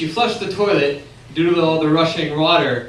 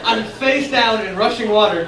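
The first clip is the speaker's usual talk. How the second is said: ordinary delivery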